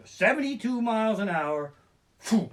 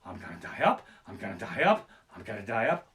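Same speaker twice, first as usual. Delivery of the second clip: low mock dread; 7 semitones lower than usual. Ferris wheel climb dread.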